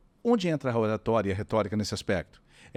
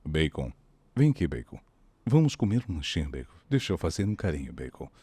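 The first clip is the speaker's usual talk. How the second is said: lower, deadpan call; deep voice to a dog; 6 semitones lower than usual. Retold or imitated. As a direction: talk to the dog like a newsreader. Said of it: "chega pro Bacon e fala assim". (voz grave falando com o cachorro)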